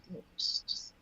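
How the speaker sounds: soft whispered start of comfort; hushed. Retold or imitated. Uncle quietly comforting his downcast nephew, whispered. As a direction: drop to a whisper, gently.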